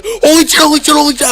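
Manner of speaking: high, loud panicked outburst; acted-out spider panic; freaking out. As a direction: re-enact a panic, repeating it in a loud high squeal. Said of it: "I am freaking out".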